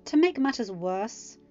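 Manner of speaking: ordinary delivery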